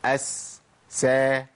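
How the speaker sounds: أس then سا, light open s; crisp unemphatic sin; sin sounds. Shown as a demonstration. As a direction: open s syllables. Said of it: "أقول".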